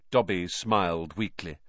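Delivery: ordinary delivery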